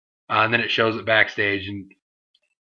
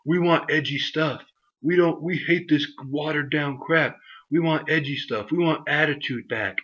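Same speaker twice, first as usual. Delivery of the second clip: higher, mocking whine; whiny fan demands; 5 semitones higher than usual. Vlogger mocking fans who demand edgy content, higher whiny voice. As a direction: chant it like an entitled fan. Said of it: "everyone's so sensitive".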